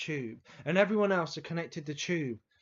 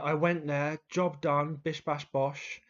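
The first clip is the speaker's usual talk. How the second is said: ordinary delivery, nothing marked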